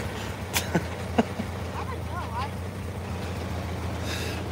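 squeaky, airless laugh; high wheezing laugh. Real, not acted. Still laughing at the truck flying by. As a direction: wheeze with laughter, barely voiced.

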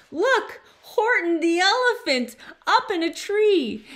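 high, loud jeering cry; gleeful shout. A narrator's reading. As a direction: shout it out in mocking glee. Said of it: "they shouted with glee".

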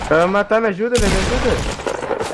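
panicked plea. Real, not acted. Teammate under fire, begging help.

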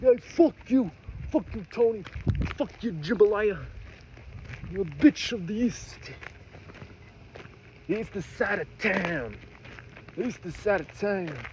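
put-on New York accent. Vlogger imagining living in New York, hammed-up New York accent. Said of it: "that's what my accent would become".

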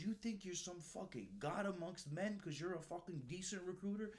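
angry yelling. Retold or imitated. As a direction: tear into him like a furious gunny. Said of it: "He goes".

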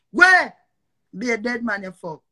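furious shout; angry shout. Real, not acted. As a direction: bark it out as a challenge. Sudden challenge in argument.

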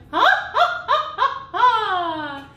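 high, loud bursts of laughter; demo laughter. Shown as a demonstration. Voice teacher leading sopranos in a laughing warm-up. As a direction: laugh the breath into motion. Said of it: "get the breath moving".

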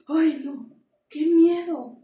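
scared exclamation. Shown as a demonstration. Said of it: "algo que me da miedo".

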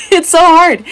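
high, loud laughing complaint; laughing exasperation. Real, not acted. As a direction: laugh it out at her own struggle.

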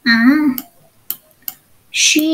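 ordinary talk, nothing marked

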